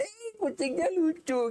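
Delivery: very high, slow, cooing delight; teacher reacting to a cat on the slide with high, slow gushing. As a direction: squeal over the cute cat. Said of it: "refleks gitu ngeliat".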